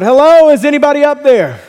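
high, loud call up a cliff; yelling out. Acted. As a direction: holler up for help, loud and high. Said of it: "he, he yells out".

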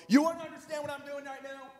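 high, strained mock yell at the phone rep; yelling at the cable company. Caller fed up with the cable company, mock furious yell. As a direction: rant like you've waited on hold for hours. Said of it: "maybe you haven't yelled on the phone like that".

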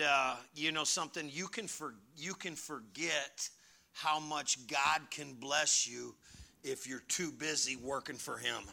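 ordinary delivery

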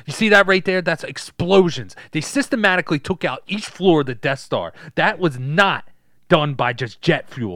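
loud, insistent crank explaining his evidence; punchy, worked-up conspiracy rant; conspiracy theorist. Acted. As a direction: rant like a proud New York truther. Plays ranting conspiracy theorist.

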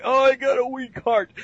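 put-on high, frail old man whining about his heart; weak-hearted old man. Mocking a comic-book character with a weak heart, frail old voice. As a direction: put on a feeble old codger voice.

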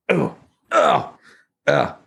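high mock groan of discomfort; pained groans. Man rolling over in bed needing to piss, acted groans. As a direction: groan as if waking to an urgent bladder. Acting out waking with bladder pain.

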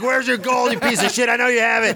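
high-pitched comic demand; mock bully. Bully shaking down a leprechaun for gold, mock-aggressive.

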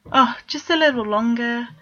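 high, coaxing little plea; pleading. A narrator's reading. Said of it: "Vegard asked".

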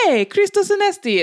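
high, loud cheerful greeting; friendly Greek greeting. Retold or imitated. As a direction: call it out like a happy stranger. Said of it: "come up to me and see my tattoo and go".

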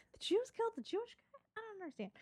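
mock confusion. Acting out baffled Irish listeners.

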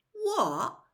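high, loud yelp of horror; sharp horrified exclamation; horror-struck. A narrator's reading. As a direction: blurt it out, appalled. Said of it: "looking horror-struck".

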